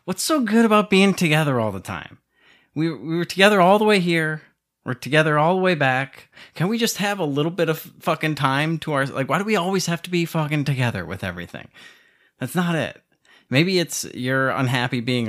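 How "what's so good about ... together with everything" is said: exasperated rant. Real, not acted. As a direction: let the exasperation boil over. Rant about always being together.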